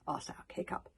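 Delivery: ordinary delivery, nothing marked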